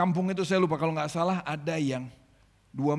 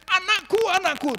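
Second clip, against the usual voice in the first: high, loud retold wail; mock wailing mother; 9 dB louder than usual. Mother wailing as her baby is taken. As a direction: cry out for the lost baby. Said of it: "ibu ini nangis, jerit-jerit".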